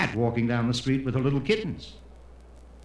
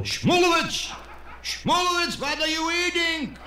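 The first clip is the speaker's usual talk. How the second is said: very high, scandalized shout; shocked yell. Acted. Four men from his temple catch him eating, yelling shocked. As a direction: call him out at the top of your range. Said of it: "and yell".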